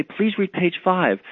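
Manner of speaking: slow, gently polite request; kind and polite. Acted. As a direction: ask kindly, unhurried and very polite. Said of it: "very kind, very politely".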